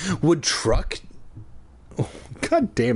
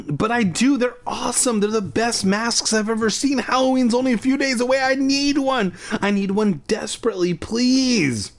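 much higher, pushing fast; desperate pleading; 10 semitones higher than usual. A narrator's reading. Boy begging the shopkeeper for a mask, desperate. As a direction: beg with everything you have.

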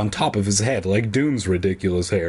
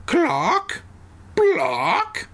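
high chicken clucks. Acted.

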